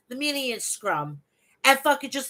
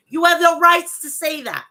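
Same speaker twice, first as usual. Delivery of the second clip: loud scolding; 4 semitones higher, 8 dB louder than usual. Real, not acted. Scolding Punk.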